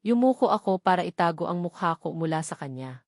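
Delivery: usual speaking voice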